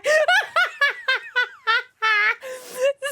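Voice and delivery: high, long laughing fit; real uncontrollable high cackling; helpless laughter. Real, not acted. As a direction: laugh until it sounds like crying. Helpless laughter at own joke.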